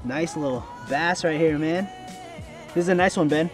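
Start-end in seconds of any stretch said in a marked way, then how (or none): none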